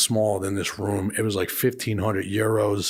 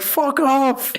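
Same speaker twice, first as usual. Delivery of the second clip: mock yell; 14 semitones higher than usual. Travelers yelling at bailiffs.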